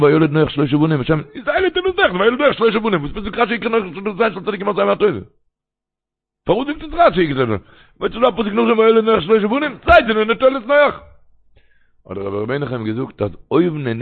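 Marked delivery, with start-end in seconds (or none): 0.0-11.0 verse quoted in a much higher, fast chanting voice; preaching. Acted. Preacher quoting the verse and Rashi to listeners, high chanting. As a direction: lift the voice high and sing out the verse.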